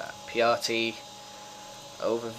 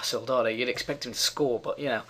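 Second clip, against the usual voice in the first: ordinary delivery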